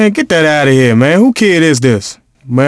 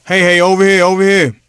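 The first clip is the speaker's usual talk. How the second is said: calling out; 4 semitones higher than usual. Acted. Calling for the ball.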